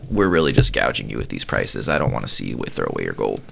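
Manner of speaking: a shopkeeper leveling with a customer, low and confidential; pitying cashier. Acted. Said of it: "kinda takes pity on you and goes".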